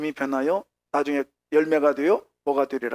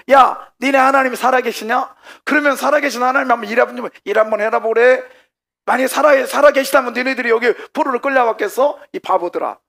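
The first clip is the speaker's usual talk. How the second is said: loud, high-pitched jeering; mocking taunt; 8 semitones higher, 9 dB louder than usual. Preacher as Babylonians scoffing at captive Israelites, loud mocking taunt. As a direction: jeer at the captives at full voice. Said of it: "막 그러면 조롱하는 거예요".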